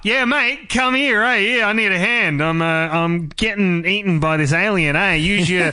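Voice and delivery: put-on broad Australian accent. Joking about the BBC, a man mimics a broad Australian accent. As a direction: play the Aussie bloke calling the doctor for help in an Australian accent. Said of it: "why is the B B C got Australian accents".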